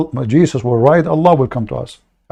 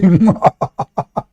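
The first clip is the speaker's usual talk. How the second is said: hearty laugh; 6 semitones higher than usual. Real, not acted. Laughing at own joke.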